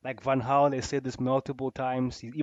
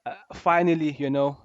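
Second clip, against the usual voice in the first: usual speaking voice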